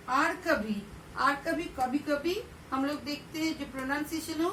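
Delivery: ordinary delivery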